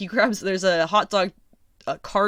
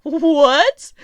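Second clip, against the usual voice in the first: high squeaky what, laughing hard; cracking up in disbelief; shrieking with laughter at a cheesy movie line; 10 semitones higher, 6 dB louder than usual. Real, not acted.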